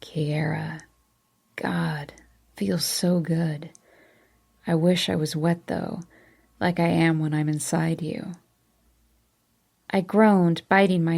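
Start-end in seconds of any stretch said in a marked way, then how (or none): 0.0-8.3 breathy aroused lover. A narrator's reading. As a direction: whisper it through pleasure. Aroused Kellen voiced.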